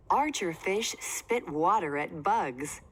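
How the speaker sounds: usual speaking voice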